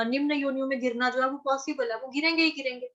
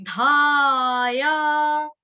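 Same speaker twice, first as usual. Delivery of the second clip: धाया pushed loud and held long; forceful धाया; 2 semitones higher, 6 dB louder, much slower than usual. Shown as a demonstration. Teacher showing students where to put power, loud and drawn out. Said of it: "power लगानी है अपनी".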